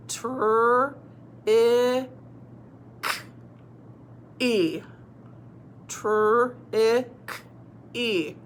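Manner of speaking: TR, short I, K, long E as separate sounds; sounding out. Shown as a demonstration. Teacher trying a long word, sounded out. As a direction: say each sound alone with pauses. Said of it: "let's try this word".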